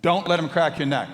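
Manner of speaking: the voice in his head shouting over him; shouted warning from the inner voice; insistent inner voice. Retold or imitated. Voice in head repeating louder.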